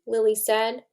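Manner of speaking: lively read-aloud to a class, voicing the kids; story narration. A narrator's reading. Teacher reading a story to her class, lively read-aloud. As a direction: read it like a picture book to children.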